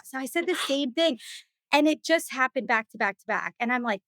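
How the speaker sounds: ordinary talk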